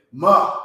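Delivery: Ma called out high and loud as one syllable; teacher stressing the one-syllable Ma; emphatic ma. Shown as a demonstration. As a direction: belt out Ma as a single sound. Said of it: "this is one sound".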